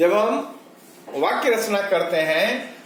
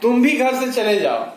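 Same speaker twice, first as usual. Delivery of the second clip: भी raised and pushed hard; stress on भी; 4 semitones higher, 4 dB louder than usual. Shown as a demonstration. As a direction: punch भी, let the rest follow. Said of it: "अब भी की बात कर लें". (भी पर ज़ोर)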